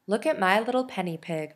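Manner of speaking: ordinary delivery, nothing marked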